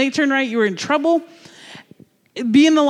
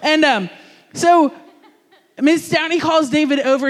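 ordinary talk, nothing marked

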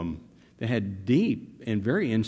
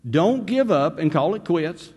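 full-force preaching; 6 semitones higher than usual. Acted. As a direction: drive it home to the congregation.

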